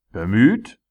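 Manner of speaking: loud, high, outraged question; furious shout. A narrator's reading. Tyrant shouting back at the dwarf, furious. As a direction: yell the word back in fury. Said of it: "schrie der Tyrann wütend".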